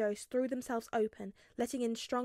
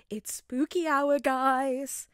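slow, loud spooky host call; hyped vlogger; 4 semitones higher, 8 dB louder, much slower than usual. A narrator's reading. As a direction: ham it up for the viewers. Vlogging to camera.

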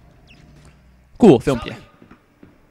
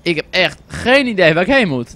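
ordinary delivery

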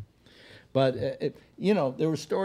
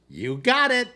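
loud, high-pitched holler; top-of-the-voice shout, pitch leaping up; retold yell; 13 semitones higher, 9 dB louder than usual. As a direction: yell it out, imitating an excited friend. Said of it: "at the top of his voice yells".

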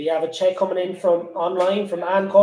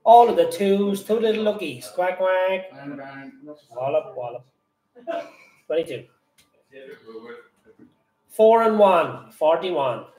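bingo caller; 3 semitones lower than usual. Acted. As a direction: call the numbers like a bingo hall. Bingo number calling.

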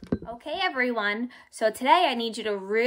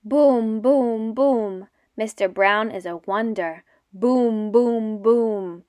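booming louder thunder; thunder booms; 3 dB louder, slower than usual. Shown as a demonstration. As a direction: boom it out big like thunder. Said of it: "Mister Brown can make thunder".

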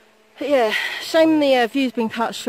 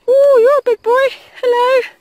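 much higher and louder ooh at the big horse; startled wonder at its size; startled greeting; 13 semitones higher, 11 dB louder than usual. Real, not acted. Hiker startled by a tall horse, high loud greeting.

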